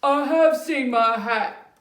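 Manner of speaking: ordinary delivery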